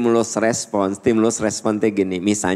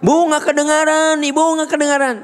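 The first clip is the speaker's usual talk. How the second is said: student complaining. Acted. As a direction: protest that you can't hear. Students complain they can't hear.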